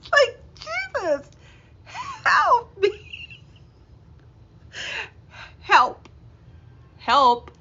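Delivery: high, laughing cry for help; loud, high comic help, help; mock plea. Real, not acted. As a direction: beg for rescue, half-laughing. Exasperated plea at own awkwardness.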